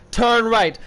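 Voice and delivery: urgent turn right; loud command. Real, not acted. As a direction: shout the instruction. Repeated louder.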